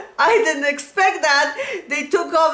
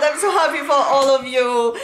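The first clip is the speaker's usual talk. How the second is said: normal talking voice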